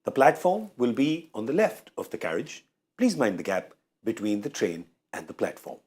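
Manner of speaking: slow, measured station announcer; railway announcement. Pretend. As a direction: read it like a platform PA. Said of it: "I was told to address you like I am a railway announcement".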